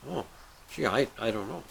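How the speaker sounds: soft, halting uncertainty; hesitant. Acted. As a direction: hem and haw quietly. Guy unsure what to wish.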